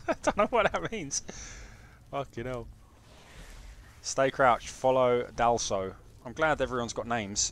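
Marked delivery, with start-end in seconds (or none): none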